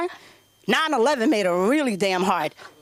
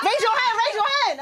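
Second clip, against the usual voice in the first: high chanting TikTok voice; high mock chant of TikTok streamers; mocking TikTok hosts; 10 semitones higher than usual. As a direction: mimic the live-stream hosts, pitched way up. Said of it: "They're like".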